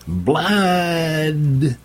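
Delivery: very high, drawn-out battle yell. Acted. Vera ordering the throw, long high yell. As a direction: let the battle cry ring out. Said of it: "Vera yelled".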